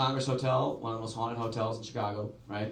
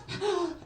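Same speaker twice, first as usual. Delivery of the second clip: sharp, high frightened gasp; scared gasp. Retold or imitated. Friend sees hotel light flicker off, scared gasp. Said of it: "she gets so scared".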